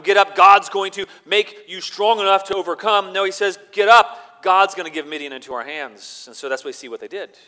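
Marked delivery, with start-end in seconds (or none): 0.0-6.3 loud, high preaching; fiery preaching. Acted. Preacher contrasting Gideon's words for the congregation, shouted preaching. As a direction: drive the point home to the congregation.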